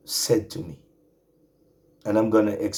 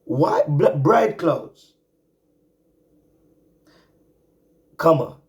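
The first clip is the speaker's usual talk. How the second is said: higher, louder preaching, drawing out key words; full-force preaching; 10 semitones higher, 10 dB louder than usual. Acted. Preacher exhorting his remnant brothers and sisters, fiery and high. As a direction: preach it, lift the congregation.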